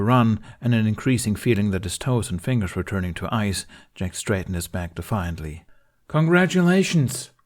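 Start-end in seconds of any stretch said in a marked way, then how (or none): 6.1-7.3 loud defiant call. A narrator's reading. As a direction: call it out into the dark. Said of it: "he called out into the darkness".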